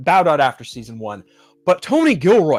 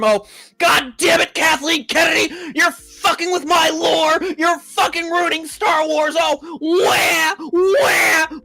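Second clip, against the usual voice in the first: whiny crying fanboy; 12 semitones higher, slower than usual. Retold or imitated. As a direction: wail like a man-child throwing a tantrum, much higher. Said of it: "bitching up a storm".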